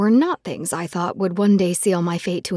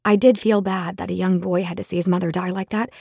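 plain reading voice

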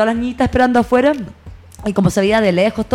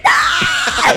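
very high shouted no; shocked no. Retold or imitated. Fan hearing the couple broke up, high shouted no. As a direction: cry out in disbelief. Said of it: "yo te juro que dije".

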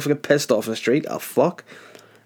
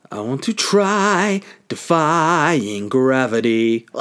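very slow, higher, grandly sung-out line; mock last words; 3 semitones higher, 3 dB louder, much slower than usual. Mock last words before the leap, slow and grand. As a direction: announce it like a doomed showman.

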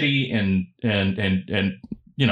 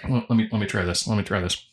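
ordinary talk, nothing marked

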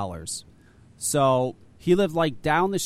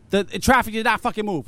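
higher and louder, fed-up burst; exasperated outburst; 6 semitones higher, 5 dB louder than usual. Retold or imitated. Telling friends about the traffic jam, exasperated outburst. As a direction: vent the frustration, punching it out.

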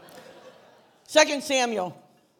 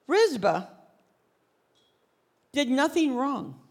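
ordinary delivery

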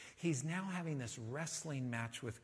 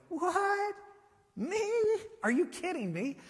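squeaky high, loud astonished outburst; high yelped what me, are you kidding; stunned disbelief; 7 dB louder than usual. Acted. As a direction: play it as Nathanael caught off guard by the praise. Nathanael's stunned reaction acted out.

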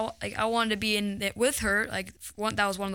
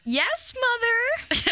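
high, slow, drawn-out "Yes, mother"; dutiful daughter line; 13 semitones higher, 4 dB louder, much slower than usual. Shown as a demonstration. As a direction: say it like an obedient child on stage. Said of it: "Let's hear it".

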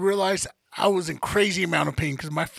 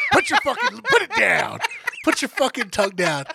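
a mock scold broken by laughter; laughing through it; 3 semitones higher than usual. Patient retelling a scolding to a friend, laughing throughout. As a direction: scold while cracking up.

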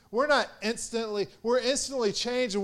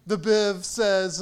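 normal talking voice

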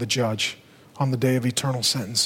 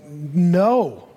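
a firm, slightly raised no; emphatic no; 3 semitones higher, 3 dB louder than usual. Real, not acted. Preacher answers the crowd with an emphatic no. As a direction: say no and mean it.

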